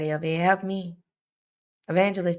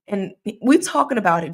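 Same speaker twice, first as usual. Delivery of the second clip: normal talking voice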